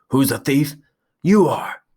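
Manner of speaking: high, loud challenge; angry. Acted. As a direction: snap it face to face. Chest to chest quarrel.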